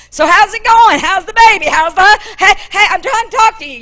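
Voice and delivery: pushy servant. Acted. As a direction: chase her for an answer. Servant running beside the donkey.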